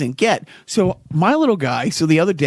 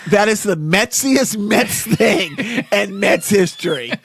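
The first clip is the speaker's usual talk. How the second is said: dramatic announcer; 4 semitones higher, slower than usual. Acted. Dramatic pronouncement.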